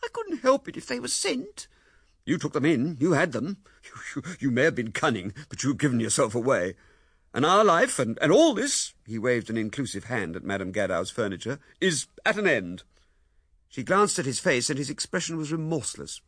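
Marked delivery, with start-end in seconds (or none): 0.0-1.7 wife protesting. A narrator's reading. Wife denying taking flowers, high pleading protest. 2.2-8.9 loud, stammering with rage; angry accusation. A narrator's reading. Husband condemning his wife, loud stammering anger. 11.8-12.8 loud, stammering with rage; angry accusation. A narrator's reading. Husband condemning his wife, loud stammering anger.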